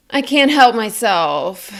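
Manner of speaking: "I can't help myself" on one breath, starting high and loud, sliding down; slow, drawn-out slide downward through one breath; falling inflection. Shown as a demonstration. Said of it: "it was on a falling inflection".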